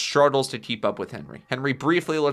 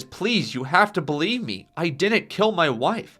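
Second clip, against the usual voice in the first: higher, urgent plea; desperate pleading; 6 semitones higher, 4 dB louder than usual. Acted. Handcuffed Henry protesting his innocence, desperate pleading. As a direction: plead to be believed, voice pitched up.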